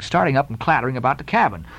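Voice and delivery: plain reading voice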